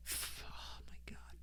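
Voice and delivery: breathy whispered aside. Real, not acted. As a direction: drop to a whisper. Exasperated aside under his breath.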